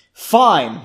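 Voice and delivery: loud, exasperated snap; frustrated. A narrator's reading. Margot giving in to Janie, frustrated and loud. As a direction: give in with loud irritation. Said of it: "agreeing in a frustrated voice".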